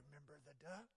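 soft unvoiced threat; whisper. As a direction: breathe the reminder secretly. Sister whispers blackmail again.

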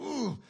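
high, loud frustrated "ooh"; growl of fury. Retold or imitated. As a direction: let pent-up outrage burst out in one sound. Noise of rage at injustice.

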